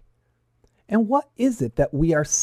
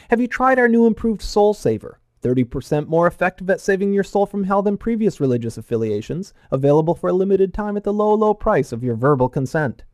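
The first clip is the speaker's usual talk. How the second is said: higher-pitched parody sales pitch; mock telemarketer; 4 semitones higher than usual. Telemarketer selling salvation to a stranger, parody sales patter. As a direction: reel it off like an advert for a miracle product. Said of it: "to the level of telemarketer, calling to sell you".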